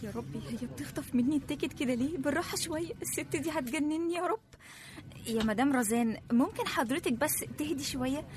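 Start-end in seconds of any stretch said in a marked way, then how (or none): 0.0-4.4 grumbling under her breath; muttered aside. Acted. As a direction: mutter it to yourself, exasperated. Assistant grumbling aside to herself. (تتمتم لنفسها)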